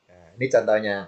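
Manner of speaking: ordinary talk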